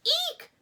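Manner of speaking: very high, loud mouse squeak. A narrator's reading. Mouse meets the gopher face to face, frightened squeak. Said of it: "squeaked Ralph".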